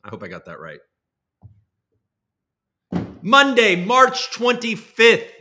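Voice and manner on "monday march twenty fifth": confident restatement. Real, not acted. Restates date after checking.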